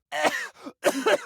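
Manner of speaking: hacking cough. Acted. Coughing up spores, mentioned.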